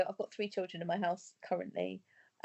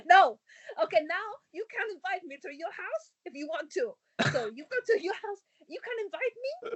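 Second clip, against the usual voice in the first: high, eager begging, much higher; pleading high voice; 10 semitones higher than usual. Retold or imitated. Mum begging the kids to invite her over, in a high eager plea. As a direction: beg them to keep playing.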